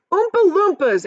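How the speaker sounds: high, loud group cry; big chorus shout of the name; excited cry. A narrator's reading. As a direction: call it out all together. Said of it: "everyone cried at once".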